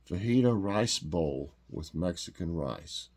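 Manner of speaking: ordinary talk, nothing marked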